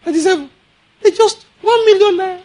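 ordinary talk, nothing marked

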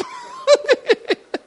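high-pitched giggle; high laugh. Real, not acted. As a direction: squeal of delighted laughter. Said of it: "You gonna laugh throughout the trip".

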